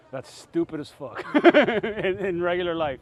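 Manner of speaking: laughs through the words; amused, slow laughing remark. Real, not acted. Laughing at Marine story.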